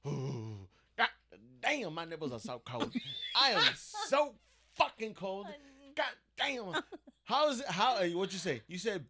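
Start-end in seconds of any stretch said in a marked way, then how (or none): 0.1-6.8 high mock whine about the cold; whiny complaint. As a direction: whine like you're freezing in the back seat. Retelling friend's complaint.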